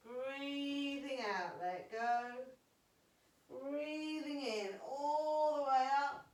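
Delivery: pace the class through each breath; guided breathing cues. Acted. Teacher cues breaths in yoga.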